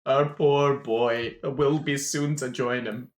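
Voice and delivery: theatrical groaning grief; pained lament. Acted. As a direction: drag out the anguish, hammed-up gnome father. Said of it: "convulses in pain and says".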